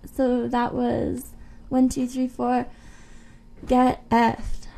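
ordinary talk

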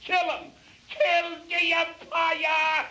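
very high, loud heckling shout; outraged fan's cry. A narrator's reading. Someone on the stand turning on the umpire, high angry shout. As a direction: holler it from the stands. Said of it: "cried someone on the stand".

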